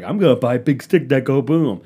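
high, loud dopey caveman voice; mock dumb guy. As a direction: play a simpleton bragging about his toy. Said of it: "people who have nothing else going on".

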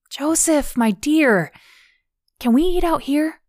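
high, loud, gushing request; playful calling out. Acted. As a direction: call out sweetly and excitedly to a dear friend. Lindsay bounces up, calls to Joseph.

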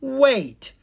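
loud, high exclamation; astonished outburst; open-mouthed surprise. A narrator's reading. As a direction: stop them short in disbelief. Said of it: "mouth was open in surprise".

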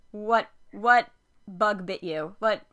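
ordinary delivery

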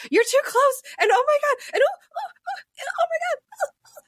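very high, whimpering panic; mock panicking mom. Daughter mimicking mom losing all pretense in the car, mock panic. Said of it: "she loses all pretense at this point".

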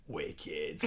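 hushed, whispered exclamation; awed whisper. Retold or imitated. Kids on the bench watching him pass, awed whisper. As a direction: imitate impressed kids under the breath. Kids' awed reaction, retold.

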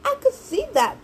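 loud complaint, leaping high on the key words; complaining. A narrator's reading. Cab driver snapping back at Tempest, complaining. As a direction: complain back at a nagging passenger. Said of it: "the driver complained".

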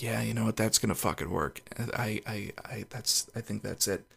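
mumbling to himself, half convinced; soft murmured verdict; quiet self-talk. Retold or imitated. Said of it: "and I was like".

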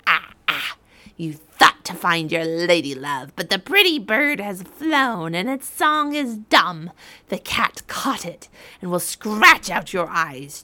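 loud, gloating witch voice; evil witch taunting the prince; mocking witch. A narrator's reading. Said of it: "cried mockingly".

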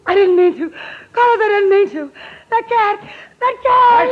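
high, crying and loud; sobbing hysterically after the lid slams. Acted. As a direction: wail it out in guilt and horror.

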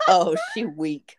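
slow sassy put-on voice, stretching the words; slow mock-sassy voice about her own weak core; sassy drawn out. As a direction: drawl it like a diva teasing herself. Repeats the sassy remark, slowed.

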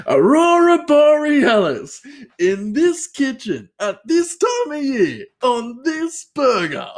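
high, loud, slow mock-incredulous cry; Chalmers quote. Mates quoting Simpsons at each burger, hammed-up. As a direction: ham it up like the cartoon boss. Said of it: "me and my mate are like".

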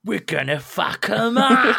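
high, slow hammed-up Cockney thug threat; mock angry Cockney. Mocking the trailer hero as a Cockney football thug. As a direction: play a Green Street hooligan, snarling in Cockney. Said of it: "he's supposed to sound angry".

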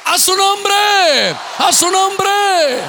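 slow, very loud, high rebuke in God's name; a preacher shouting a prayer of authority; commanding shout. Acted.

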